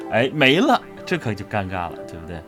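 ordinary delivery

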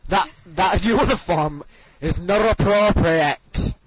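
mock disapproving teacher, barely holding back laughter; Miss Hines impression. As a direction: scold a pupil with laboured, exaggerated words. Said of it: "Okay, Miss Hines, right".

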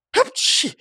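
high, squeaky "achoo"; mock sneeze. A narrator's reading. Ниса Чих sneezes amid the argument, high acted sneeze. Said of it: "чихнув".

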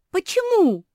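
very high, loud startled question; chorused astonishment. A narrator's reading. Жози и Мафи ask Куки why, crying out in unison. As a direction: cry out in disbelief together. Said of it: "в один голос спросили Жози и Мафи".

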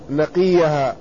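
نقيها said naqiha, i after the q; corrected with kasra. Retold or imitated. Teacher corrects the student's reading, نقيها said naqiha. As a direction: give نقيها a kasra on qaf and a y sound, no b. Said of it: "بالكسر".

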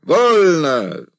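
high, loud parade order; shouted command. A narrator's reading. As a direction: call the order across the ranks. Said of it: "приказал Андрей".

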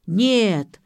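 loud, drawn cry of denial; full-voiced bellow; yelling. A narrator's reading. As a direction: shout it out in sudden alarm. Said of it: "заорал он".

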